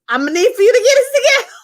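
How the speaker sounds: high-pitched, rushed teacher telling-off; mock teacher scolding. Teacher telling a student to toughen up, mock scolding.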